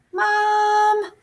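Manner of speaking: high, drawn-out plea; pleading. A narrator's reading. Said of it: "he pleads".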